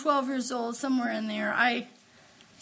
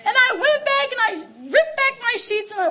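higher and louder, almost singing; overjoyed; 9 semitones higher, 7 dB louder, faster than usual. Real, not acted. Preacher tells the church how happy she was, elated. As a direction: lilt it with elation. Retelling joy, lifted voice.

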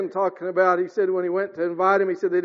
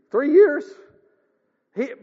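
ordinary delivery

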